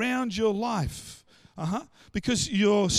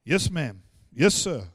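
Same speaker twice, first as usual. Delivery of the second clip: obedient command center; 6 semitones lower, 4 dB louder, slower than usual. Acted. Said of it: "You know what the command center does?".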